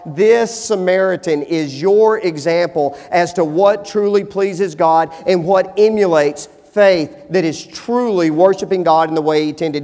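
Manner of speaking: ordinary talk, nothing marked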